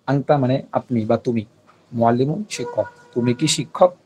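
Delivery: ordinary talk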